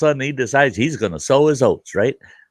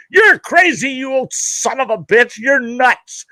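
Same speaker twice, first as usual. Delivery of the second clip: much higher yell from down the road; yelled insult; 13 semitones higher than usual. Retold or imitated. Son yelling back at his dad from the road, high yell. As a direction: shout it back over your shoulder. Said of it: "He goes".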